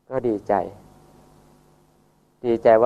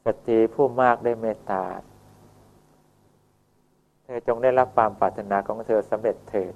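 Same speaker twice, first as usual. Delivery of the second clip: solemn gentle blessing. Acted. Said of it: "ท่านก็เลยคิดว่า".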